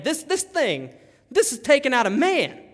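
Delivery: high-pitched, overwhelmed stammer; excited, tongue-tied amazement; freaking out. Acted. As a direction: exclaim it as if unable to believe it. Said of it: "This guy's freaking out here".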